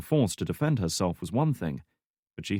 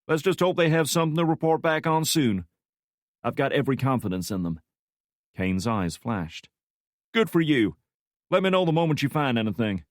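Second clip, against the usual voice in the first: higher, louder curt boss; 5 semitones higher, 4 dB louder than usual. Acted. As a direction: dismiss him coolly. Kane dismisses Franklin.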